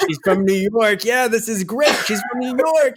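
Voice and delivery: chuckling at the New York bit; delighted repeat. Retold or imitated. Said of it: "I kept saying".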